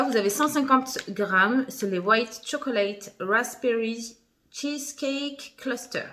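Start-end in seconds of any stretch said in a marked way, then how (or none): 2.0-6.0 English product name with a thick French accent, slowly; halting French-accented English. Real, not acted. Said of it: "Désolée pour mon accent pourri".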